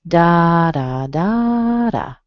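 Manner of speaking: "Da, da, da, da" with first and third long and strong; stress pattern demo. Shown as a demonstration. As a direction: chant DA-da-DAA-da, third beat strongest. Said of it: "the pattern that is like this".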